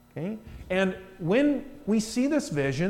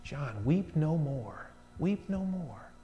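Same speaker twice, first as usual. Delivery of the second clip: comforting elder; 5 semitones lower, 8 dB quieter than usual. Acted. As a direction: gently comfort a weeping man. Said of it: "he comforts him".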